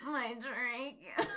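soft, drawn-out mock moan. Co-reader copying Vampire's cry, mock moaning. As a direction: echo the moan slowly and softly.

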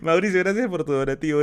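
thanks spoken through leftover laughter; laughing thanks. Real, not acted. Streamer thanking a donor while still laughing.

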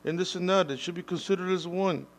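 mock the offended voices; outraged critic. Imitating people who disagree with him, high and indignant. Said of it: "disagree with me and say".

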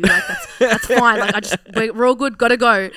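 high, loud, laughing brush-off; dodging partner. Retold or imitated. Imitating partner brushing off the talk, high and loud. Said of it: "and he's like".

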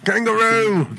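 high, loud mock Aussie; hammed-up Australian accent shout. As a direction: yell it in a cartoon Australian accent. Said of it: "You think Australians say kangaroo".

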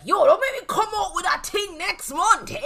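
high, loud, laughing warning; laughing mock threat. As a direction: squeal it through laughter. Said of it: "be like".

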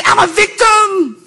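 mock the victim excuse; mock whine. Pastor mocking the victim excuse, high and slow. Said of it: "by saying".

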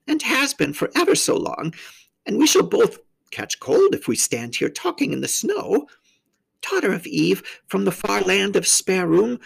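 high-pitched faun character voice; grandly polite invitation, pitched well up; courteous faun. A narrator's reading. Faun inviting the girl to tea, high and courteous.

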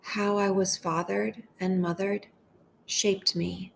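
gentle, unhurried meditation voice with long pauses; hushed, measured devotional leading; guided prayer meditation. Acted.

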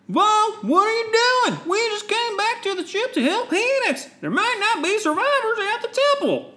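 high, bright heroic voice; young Jedi voice. Acted. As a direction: play the eager young Jedi. Voicing young Jedi in comic.

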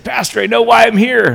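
high, loud and eager with joy; a dying man bursting with his news; excited. Retold or imitated. Gary tells the vicar his discovery, excited and loud. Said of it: "he was all excited".

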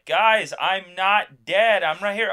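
mock desperate call to be noticed; Faramir protesting. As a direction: cry out in comic exasperation. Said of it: "what if Faramir could actually speak".

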